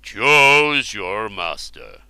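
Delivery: slow, commanding king's voice; a lightly voiced stern Ubar; stern ruler. Ubar commanding the slave girl, slow and stern. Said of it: "said Marlenus".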